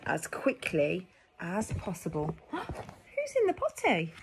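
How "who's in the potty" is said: high, slow playful question; teasing surprise; surprised high question. Acted. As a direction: ask in mock surprise.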